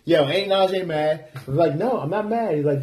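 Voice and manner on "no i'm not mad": higher-pitched insistent mock denial; mildly irritated denial. Friend pressed a second time, mildly irritated. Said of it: "I can see you getting mad".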